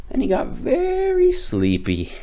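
high, dreamy drowsy tone; sleepy, drifting voice. A narrator's reading. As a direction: yawn it out. Badger gets sleepy.

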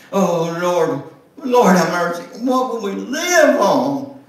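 high, loud wail to the Lord; despairing plea. Acted. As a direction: cry out to heaven in despair. Mother prays in despair.

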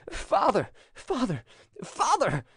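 high, urgent calling out; piercing cry. A narrator's reading. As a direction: call out piercingly for help, pitch way up. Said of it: "he cried in a piercing voice".

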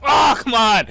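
loud groaning outburst; frustrated shout. Real, not acted. Killed by an enemy, a loud frustrated shout. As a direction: yell at the game.

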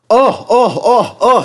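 high-pitched yelps, one after another; screaming trucks. A narrator's reading. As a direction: squeal in protest like rattled trucks. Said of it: "screamed the trucks".

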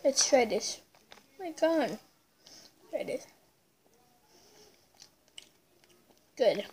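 talking while eating cake; muffled speech around food; mouth full, chewing. Real, not acted. Seen 1: Child bites cake pieces and chews throughout the labelled words.

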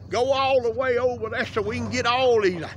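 ordinary talk, nothing marked

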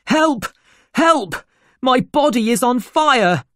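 high, loud cry for help; panicked shout. Acted. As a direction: shout out as if on fire. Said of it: "the doctor shouted".

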